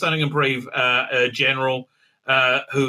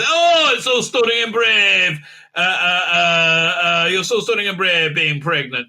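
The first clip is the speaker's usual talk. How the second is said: mocking praise; 4 dB louder than usual. Mocking the show's praise of the pregnant man, loud and sarcastic. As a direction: shout the praise in open sarcasm.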